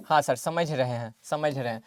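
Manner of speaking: students' dutiful reply. Acted. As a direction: answer low and quiet, as the class would. Teacher voices the students' reply. (छात्रों की धीमी, हल्की आवाज़ में जवाब)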